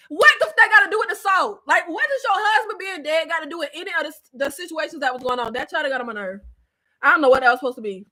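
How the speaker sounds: usual speaking voice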